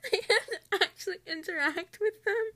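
laugh-sob in disbelief; dismayed about working in pairs; laughing despair. Real, not acted. Learns work is in pairs.

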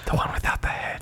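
hushed whisper. Acted. [name] whispering back to Seth Rogen, hushed. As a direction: drop to a whisper. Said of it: "we don't need to whisper".

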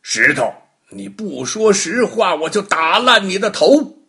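a furious judge threatening to smash the stone; angry threat. Acted. Angry Baogong threatens the silent stone, loud furious shout. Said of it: "包公发火了".

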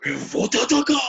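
hammed-up movie villain threat; a hammy actor overplaying a threat; overdramatic hammy actor. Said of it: "era bué aquele efeito dramático do tipo".

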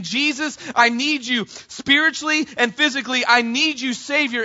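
loud, high urgent plea; pleading cry. Retold or imitated. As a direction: beg him with everything. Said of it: "He's saying".

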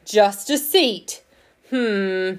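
slow, loud growly indignation. A narrator's reading. Stone lion repeating the fox's insult, growly and slow. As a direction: growl it slowly, affronted. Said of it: "said the growly voice".

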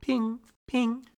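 high, thin radar ping; ping like a tiny radar; high sonar ping. Retold or imitated.

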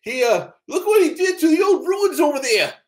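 put-on high, loud, frantic old-timer shout; old prospector. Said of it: "He goes".

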